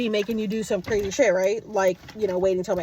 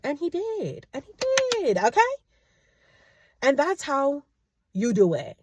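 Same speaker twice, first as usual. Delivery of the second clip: gloating; 6 semitones higher, slower than usual. Real, not acted. Gloating he paid.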